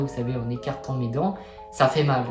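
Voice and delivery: ordinary talk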